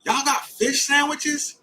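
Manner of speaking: high, slow hopeful question; hopeful surprise. Retold or imitated. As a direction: ask it like you can't believe your luck. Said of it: "And I was like".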